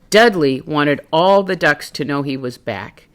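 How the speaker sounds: ordinary delivery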